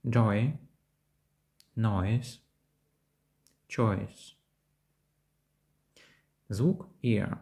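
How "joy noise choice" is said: "Joy", "Noise", "Choice" with O gliding into a short I; дифтонг ой. Shown as a demonstration. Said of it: "подібно до звукосполучення Ой". ("Joy", "Noise", "Choice" зі звукосполученням Ой)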